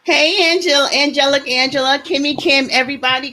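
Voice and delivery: loud, high, bubbly hello; loud excited welcome; excited greeting. Real, not acted. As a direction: call out to the viewers with excitement.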